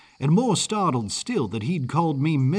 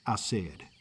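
usual speaking voice

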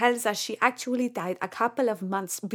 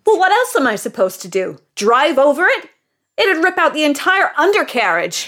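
louder, exasperated complaint; 4 semitones higher, 7 dB louder than usual. Acted. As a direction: push back, fed up and incredulous. Exasperated customer at rental desk.

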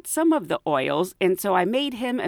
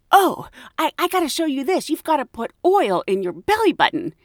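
higher, eager rush of enthusiasm; imitating a coworker bursting with a tip; animated; 7 semitones higher than usual. Flight attendant eagerly pitching belly-button oil, higher and animated. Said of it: "she got all animated".

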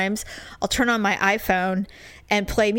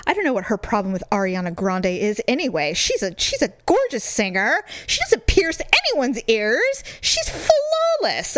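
retold indignant rant. Woman ranting to him in the car, increasingly indignant. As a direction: defend her with mounting mock indignation. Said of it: "I'm just going on and on".

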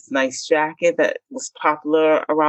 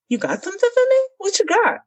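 very high, eager mocking voice; squeaky excited boyfriend impression; greedy boyfriend; faster than usual. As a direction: mimic him as a needy little boy.

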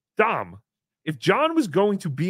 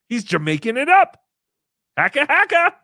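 hammy loud joke; 7 semitones higher, 8 dB louder than usual. Acted.

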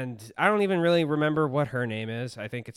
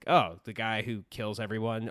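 ordinary delivery

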